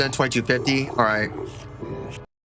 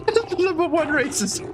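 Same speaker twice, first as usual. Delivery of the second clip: laughing; 12 semitones higher than usual. Real, not acted. Laughing at own slip.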